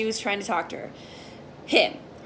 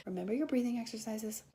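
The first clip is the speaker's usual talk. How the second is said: very quiet, quick murmur; calm yourself down under your breath; tiny self-soothing reminder; hushed aside; 16 dB quieter, faster than usual. Pretend.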